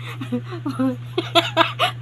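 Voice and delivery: high breathy giggle. Real, not acted. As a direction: burst into giggles. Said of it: "Natatawa, natatawa ako".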